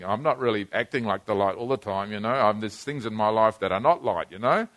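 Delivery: a quiet inner voice of doubt; quiet doubting self-talk. Retold or imitated. Preacher voicing a believer's private doubt, quiet and low. Quoting his own doubting thoughts.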